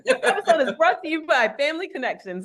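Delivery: upbeat ad read, laughing; podcast ad read. Pretend. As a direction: sponsor read through laughter. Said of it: "sound like you're reading a podcast".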